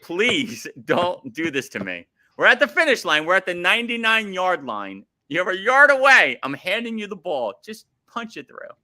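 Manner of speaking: rally him like a coach at the goal line; impassioned rant. Real, not acted. Fan ranting at his favorite wrestler, impassioned begging.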